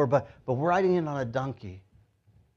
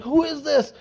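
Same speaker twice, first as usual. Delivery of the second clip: high, astonished question; crazed excitement; 11 semitones higher than usual. Acted. Baffled townsperson asking about Jesus, crazed excitement. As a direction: ask it amid a commotion. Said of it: "craziness happening right here".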